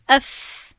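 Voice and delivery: اف, its final f held as an easy airy hiss; continuous fricative. Shown as a demonstration. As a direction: let the air flow freely through the ف. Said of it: "ف همین ف دوام کرد".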